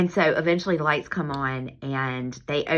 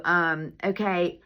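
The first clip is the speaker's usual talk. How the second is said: ordinary talk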